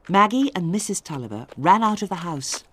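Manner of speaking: ordinary delivery, nothing marked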